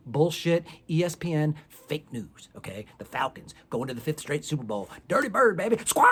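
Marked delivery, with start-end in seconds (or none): 5.1-6.1 loud hyped bird-call shout; yelling squawk. Real, not acted. Fan on his porch yelling squawk for his team. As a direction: whoop it like a crowing fan. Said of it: "I'm yelling squawk on my back porch".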